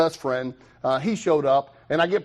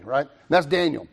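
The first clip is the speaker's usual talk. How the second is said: ordinary talk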